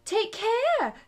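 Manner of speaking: high, loud urgent warning; cried out. A narrator's reading. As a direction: call out in alarm. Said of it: "Alice cried".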